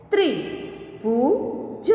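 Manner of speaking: slow, each sound drawn out, rising at the end; slow drawn-out reading. Shown as a demonstration. Teacher slowly sounding out a word for students, drawn out. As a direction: stretch the word slowly for the class. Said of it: "के उदाहरण हम देखें".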